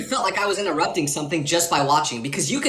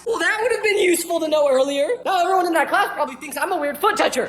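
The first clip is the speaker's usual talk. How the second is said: laughing at himself; 11 semitones higher than usual. Real, not acted. Embarrassed aftermath.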